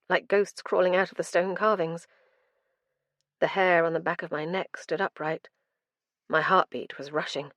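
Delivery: plain reading voice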